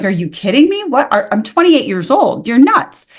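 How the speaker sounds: incredulous outburst. Retold or imitated. Retelling her reaction to the doctor's verdict, incredulous. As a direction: react like the diagnosis is absurd.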